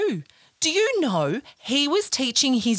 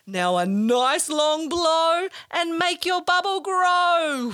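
guided breathing; 4 semitones higher than usual. Acted. Guides children's breathing exercise.

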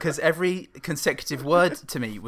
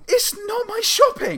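much higher, a strained, near-crying protest of innocence; high-pitched protest; 5 dB louder than usual. Retold or imitated. Repeating to the pushy woman it's not his shopping, high strained protest. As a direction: pitch it way up like you're close to tears. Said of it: "the second time when I did my".

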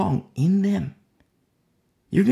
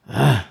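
low, loud grumbling groan; frustrated grunt; 4 semitones lower, 5 dB louder than usual. Acted. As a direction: vent a little frustration. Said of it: "a little frustration".

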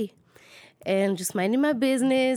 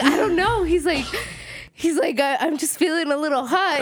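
laughing quote; 6 semitones higher than usual. Retold or imitated. Wife quoting her drugged husband, laughing. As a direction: voice the husband while cracking up.

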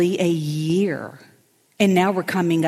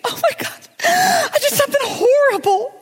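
much higher, crying wail of panic; higher panicked sobbing voice; panicked friend; 4 dB louder, slower than usual. Retold or imitated. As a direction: squeal it out like the world is ending. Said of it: "she's like, in a panic".

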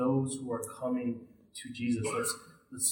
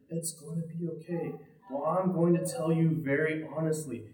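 normal talking voice

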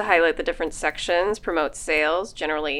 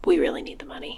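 whispered aside; faster than usual. Acted. Aside to listeners.

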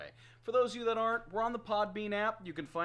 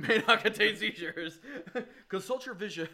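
raised, laughing spoof of a product warning; laughing mock disclaimer; 3 semitones higher, 5 dB louder than usual. Host joking about seizure warnings for blinged controllers, laughing. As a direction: giggle through a mock warning label.

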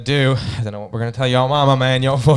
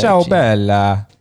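slow, louder mock Italian; Italian accent; 5 dB louder, much slower than usual. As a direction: draw it out in a fake Italian accent. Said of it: "You do an Italian voice there".

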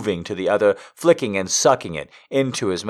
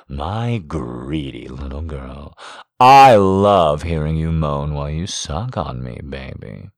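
praising; 5 semitones lower, 3 dB louder than usual. A narrator's reading. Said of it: "he praised".

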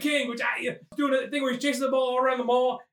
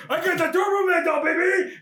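higher, louder gleeful shout; triumphant cheer; 6 semitones higher, 3 dB louder than usual. Acted. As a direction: crow in triumph. Arnold triumphant finding doll.

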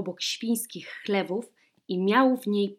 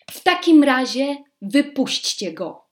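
louder, higher huffy order; petulant command; 5 semitones higher, 8 dB louder than usual. A narrator's reading. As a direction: princess snapping to let it go. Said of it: "powiedziała księżniczka".

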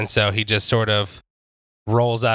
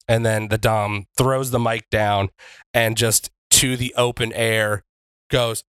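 ordinary talk

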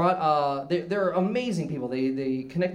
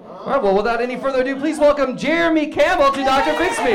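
fast, much louder, higher game-show announce; announcer intro; 6 semitones higher, 6 dB louder than usual. Acted. Host introduces Jeremy Campbell to the audience, shouted announcer welcome. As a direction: boom it out like a TV host bringing on a guest.

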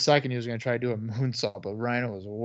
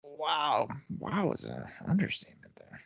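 whispering; 3 semitones higher, 7 dB quieter than usual. Real, not acted. Whispered awe after pin.

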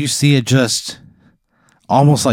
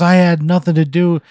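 pleading excuse; 9 semitones higher, 4 dB louder than usual. Acted. Acted principal pleading before attack.

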